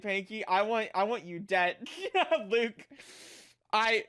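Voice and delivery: usual speaking voice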